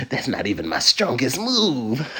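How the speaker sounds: playful hint of a smug villain; mock villain. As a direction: tease like a boss who's holding back. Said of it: "I'm feeling like a villain".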